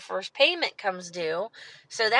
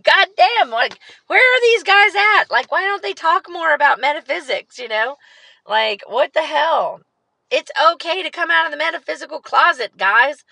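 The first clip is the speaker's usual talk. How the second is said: excited rant; 6 semitones higher, 6 dB louder than usual. Real, not acted. Ranting about reticent metaphysical guys.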